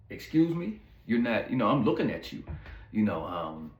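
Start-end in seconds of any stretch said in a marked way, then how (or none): 0.1-1.5 shocked disbelief. Retold or imitated. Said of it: "And I'm like".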